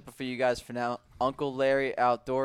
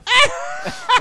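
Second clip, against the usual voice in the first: high burst of laughter; 7 dB louder than usual. Real, not acted. Reacting to TikTok joke.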